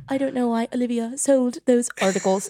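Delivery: mock-tearful, laughing through it; Meghan Markle crying. Playing a wronged daughter on BBC morning, mock-crying. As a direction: play at crying on morning television. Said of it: "I'm, like, crying".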